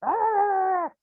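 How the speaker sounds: short hammed-up cranky yell; frustrated growl. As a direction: growl like a cranky nicotine-starved grouch. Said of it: "this morning I was like".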